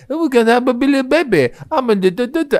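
very high babble of a pretend baby. Copying the new kid at school, high baby voice. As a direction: put on a babyish high-pitched voice. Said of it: "talking in baby voices".

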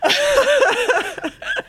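long high groaning sigh; loud exasperated sigh. Real, not acted. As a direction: heave a loud despairing sigh. Dismay at the post.

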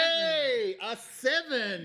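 high, loud, drawn-out cheer; excited cheer. Real, not acted. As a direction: celebrate the roll with a big rising shout. Celebrating the dice roll.

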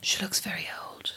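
hushed, breathy aside about someone nearby; a quick unvoiced whisper behind her back; mum's whispered verdict. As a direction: whisper it so she can't hear. Mum's reaction to Sonia.

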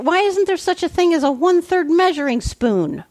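high, exasperated complaint. Real, not acted. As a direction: throw up your hands at it. Frustrated at missing spoon.

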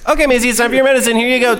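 cheerfully dosing the cat; chirpy owner voice. Retold or imitated. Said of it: "I'm like".

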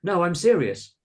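high, loud, insistent plea; pleading. A narrator's reading. Carolina to children who laughed at her, pleading. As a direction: plead to be believed. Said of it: "Carolina pleaded".

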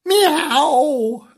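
high, loud drawn-out meow; kitten meow. Acted. Bernard the kitten answering, high playful meow. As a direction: mew like a little kitten. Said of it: "So Bernard said".

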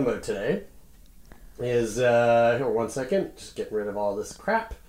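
distant from the mic; speaking away from the mic. Real, not acted.

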